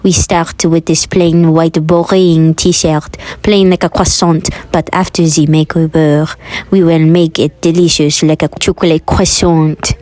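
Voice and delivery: put-on French accent. Host introducing the final T-shirt project, in a put-on French accent. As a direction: narrate in a mock French accent. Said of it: "I'm done doing the French accent now".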